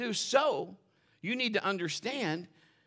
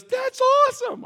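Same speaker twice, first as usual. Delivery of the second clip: much higher and louder, slowed with amazement; delighted cry; 13 dB louder, slower than usual. Retold or imitated. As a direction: squeal it out in disbelief. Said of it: "And she goes".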